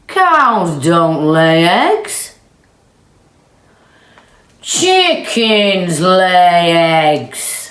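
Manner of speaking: very slow, loud and deliberate; slow emphatic. Acted. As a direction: spell it out word by word. Cows reasoning emphatically.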